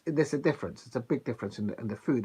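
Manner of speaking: ordinary talk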